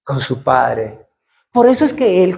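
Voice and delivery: ordinary talk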